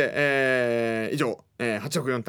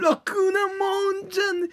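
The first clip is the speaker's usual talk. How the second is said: much higher, slow, drawn-out catchphrase; silly catchphrase voice; 4 dB louder, slower than usual. Acted. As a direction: stretch the catchphrase out in a high squeal.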